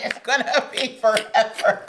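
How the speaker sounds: cracking up mid-line at her own joke; laughing. Real, not acted.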